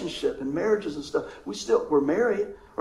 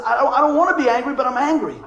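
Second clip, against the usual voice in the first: imitating angry griever; 6 semitones higher, 9 dB louder than usual. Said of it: "I talk to people and they said".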